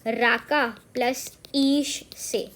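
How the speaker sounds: teacher drawing out each piece for students; slow word breakdown. Shown as a demonstration. Teacher splits Rakesh for students, slow and deliberate. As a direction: slowly spell out the word's parts. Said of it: "Rakesh word बना है".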